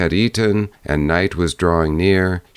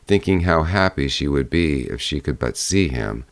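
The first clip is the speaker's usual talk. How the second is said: ordinary delivery, nothing marked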